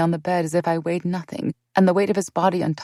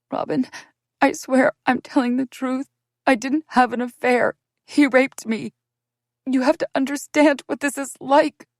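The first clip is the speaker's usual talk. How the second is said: higher, louder, urgent pleading; desperate plea; 8 semitones higher, 4 dB louder than usual. Acted. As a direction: beg her to believe you. Pleading with Robin.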